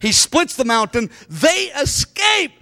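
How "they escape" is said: high, slow, shouted exclamation; excited shout. Real, not acted. Preacher to congregation, an excited shout. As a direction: cry it out to the room.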